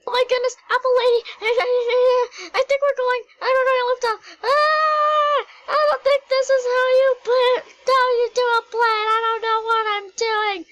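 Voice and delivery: high, loud shrieks of panic; panicked screaming. Pretend. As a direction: scream as the plane goes down. Plane out of control, panicking.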